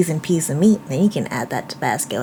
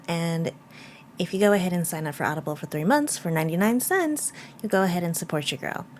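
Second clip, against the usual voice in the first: quiet voiceover; 8 dB quieter than usual. Real, not acted. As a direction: murmur it like a quick editing note. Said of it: "Editing mama here".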